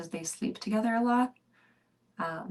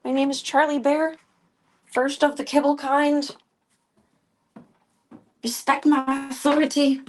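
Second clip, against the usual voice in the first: high, loud bossy cat declaring her rank; 6 semitones higher, 6 dB louder than usual. Retold or imitated. Charlie the cat demanding the kibble, bossy and loud. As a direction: puff up like a tiny tyrant queen. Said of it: "She's like".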